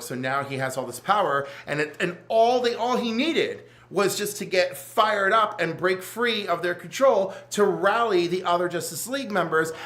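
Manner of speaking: ordinary delivery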